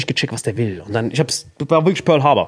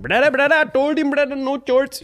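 imitating the pushy attendant, high; mock attendant; 9 semitones higher than usual. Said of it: "dieser Brother hinten dran". (hoch nachgemachter Flugbegleiter)

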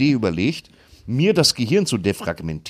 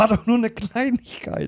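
mock innocent voice; 13 semitones higher than usual. Mock excuse in put-on voice.

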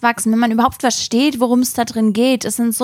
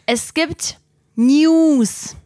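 dramatic slow announcement; 4 semitones higher, much slower than usual. Acted.

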